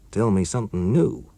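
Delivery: plain reading voice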